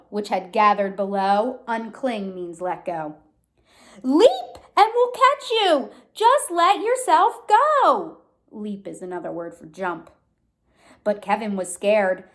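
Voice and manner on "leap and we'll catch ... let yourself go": high, loud coaxing call; urging call. A narrator's reading. The crowd urging Kevin to leap, high and loud. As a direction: urge him to jump.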